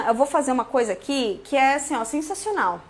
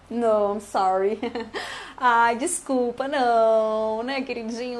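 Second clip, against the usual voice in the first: drawn-out, mock-sweet apology with little laughs; sweetly apologetic refusal; slower than usual. Teacher turning down a stranger asking for the phone, sweetly apologetic.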